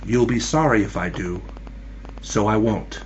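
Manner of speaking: soft, low, restrained answer; reply quietly, well under the storm. A narrator's reading. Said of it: "her quiet and only reply was".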